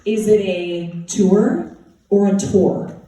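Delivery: "tour" said first as Utah "tor", then as "toor"; two pronunciations. Shown as a demonstration. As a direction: contrast the Utah "tour" with the standard "tour", slowly. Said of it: "That's this word".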